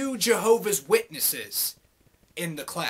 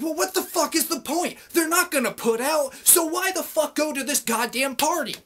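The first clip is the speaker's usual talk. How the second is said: pitched up high, mock-outraged scoff; retold exasperated retort; 8 semitones higher, 4 dB louder than usual. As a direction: scoff at the whole idea.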